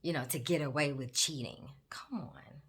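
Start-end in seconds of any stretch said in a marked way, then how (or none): 1.9-2.6 soft whispered "Come on" scolding. Real, not acted. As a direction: breathe "Come on" as a gentle scold. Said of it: "whispered scolding then plain".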